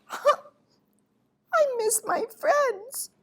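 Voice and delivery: slow, high, plaintive owl voice; lonely owl. A narrator's reading. Owl alone, missing his friends, high plaintive voice. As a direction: draw it out high and forlorn. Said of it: "He missed his bird friends".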